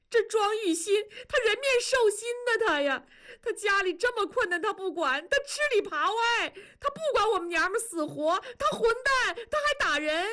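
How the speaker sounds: very high, sobbing denunciation; wailing in tears. Acted. Battered wife begging the master for help, wailing. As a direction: wail out each grievance at a high pitch. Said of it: "庄嫂痛哭流涕喊道".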